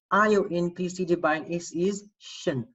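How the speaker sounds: ordinary talk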